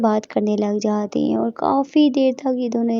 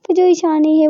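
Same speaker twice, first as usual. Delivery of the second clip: plain reading voice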